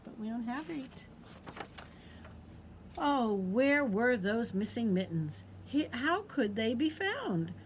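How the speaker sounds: usual speaking voice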